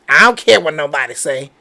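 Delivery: quick, low offhand brush-off; mock dismissive. Host quoting people who claim not to care, mock dismissive. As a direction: lightly imitate people shrugging off opinions. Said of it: "when they say".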